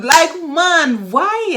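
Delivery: high, loud exasperated why. Real, not acted. Fed up with people shouting on the phone, exasperated. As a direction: throw up your hands in disbelief.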